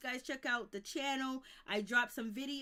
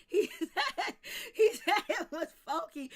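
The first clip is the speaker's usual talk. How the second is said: words burst out through loud laughter; laughing; 6 semitones higher, 8 dB louder than usual. Real, not acted. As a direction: laugh your way through the line. Laughing hard at chat joke.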